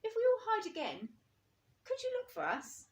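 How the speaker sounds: high, small bear voice asking; Little Bear's voice. A narrator's reading. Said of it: "said Little Bear thoughtfully".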